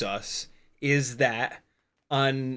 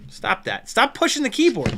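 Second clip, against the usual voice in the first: scolding the dog; 8 semitones higher, 4 dB louder than usual. Real, not acted.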